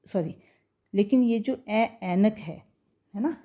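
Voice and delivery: ordinary talk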